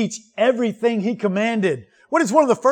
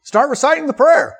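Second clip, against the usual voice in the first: mock dismissive; 5 semitones higher than usual. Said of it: "oftentimes we say".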